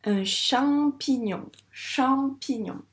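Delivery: "champignon" very slowly, stretching cham, then pignon; mot bien articulé. Shown as a demonstration. Teacher models word for listening. (« champignon » lentement, en syllabes appuyées)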